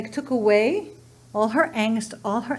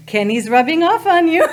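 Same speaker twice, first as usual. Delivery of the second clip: raised, louder voice bubbling into laughter; laughing tease; 5 semitones higher, 6 dB louder than usual. Retold or imitated. Wife retelling her tease to husband, laughing. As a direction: tease playfully through a laugh.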